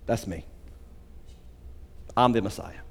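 very soft and low, with a pause between phrases; quiet revelation. Acted. Jesus revealing himself to the woman at the well, quiet and low. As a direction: drop right down for a hushed disclosure.

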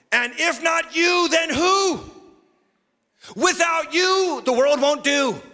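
high, ringing preacher's cry; full-force preaching. Real, not acted. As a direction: preach it high, stretching out the last phrase.

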